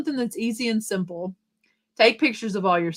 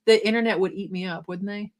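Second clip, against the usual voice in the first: ordinary talk, nothing marked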